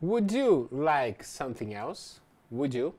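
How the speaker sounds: slow, careful read with the question rising; slow model question. Shown as a demonstration. Teacher models a waiter's question for students, slow and careful. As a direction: read it slowly so learners catch every word. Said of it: "acompanhe os exemplos".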